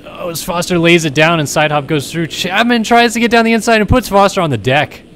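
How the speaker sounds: excited rising race call; motorsport commentator. Real, not acted. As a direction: call the crash with mounting excitement. Crash call in live race.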